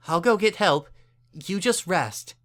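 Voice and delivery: a caring mare coaxing a stranger; kind Earth Pony mayor. A narrator's reading.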